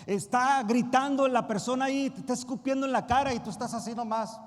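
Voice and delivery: loud, rising sermon cry; preaching at full force. Acted. Pastor urging the church to commit, loud preaching. Describes bootcamp shouting.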